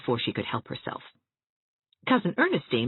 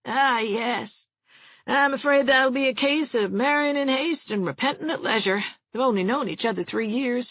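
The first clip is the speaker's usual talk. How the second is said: put-on higher, fretful voice of a doom-saying old woman; gloomy old cousin; 11 semitones higher than usual. A narrator's reading. Ernestine speaking again.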